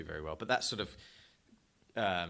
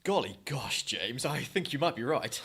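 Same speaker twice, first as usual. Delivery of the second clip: posh English voice; 4 semitones higher, 4 dB louder than usual. Pretend. Mock posh reply to crack America.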